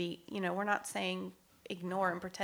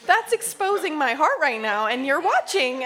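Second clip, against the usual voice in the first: loud, high-pitched comic objection; mock protest; 8 semitones higher, 11 dB louder than usual. Woman playfully objecting to God watching her heart, loud mock protest. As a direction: exclaim in exaggerated exposed embarrassment, louder and higher.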